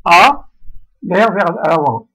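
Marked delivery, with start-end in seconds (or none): 0.0-0.5 "A" with the mouth wide open, loud; loud, wide-mouthed vowel "A". Shown as a demonstration. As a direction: sound a strong open "A", air sent forward. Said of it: "bouche ouverte".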